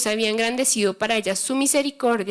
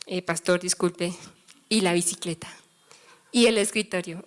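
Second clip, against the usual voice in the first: lower, hushed skeptical voice asking for proof; doubtful church folk; 7 semitones lower, 3 dB quieter than usual. Retold or imitated. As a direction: ask slyly, low and doubtful, as if catching him out. Church people doubt the pastor. (gente incrédula, voz más grave y baja, preguntando con sospecha)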